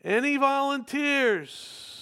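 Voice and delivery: high, drawn-out call. Real, not acted. Preacher asking congregation for volunteers, calling out. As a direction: call slowly across the whole room.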